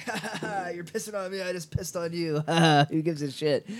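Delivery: imitating a giggling, carefree friend; mock laughing. Bleeb laughs while peeing on Blob, mock laughing. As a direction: laugh through it as if retelling a prank. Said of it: "he thinks it's funny".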